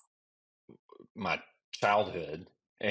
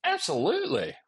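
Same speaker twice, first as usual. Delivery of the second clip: squeaky high absolutely; mock high agreement. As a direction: hit it in a jokey falsetto. Mock agreeing to Coke joke.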